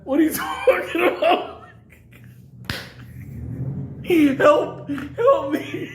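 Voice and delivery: high, strained cry for help, half laughing; mock panic. Handcuffed prisoner begging guards for rescue, high hammed-up panic. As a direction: squeal like you're trapped with the plague. Friend panics on hearing about aids.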